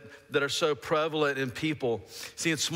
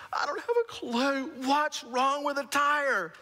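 exasperated shout; 13 semitones higher, slower than usual. Retold or imitated. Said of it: "I'm like".

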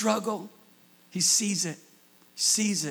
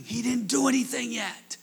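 ordinary talk, nothing marked

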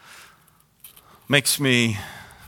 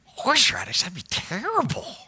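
disgusted; 5 semitones higher than usual. Retold or imitated. Disgusted reaction to suggestion.

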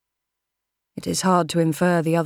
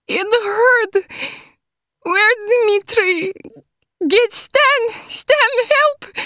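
put-on very high, loud panicked Russian girl; desperate cries; 6 dB louder, slower than usual. A narrator's reading. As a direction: scream for help in broken English. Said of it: "she cries".

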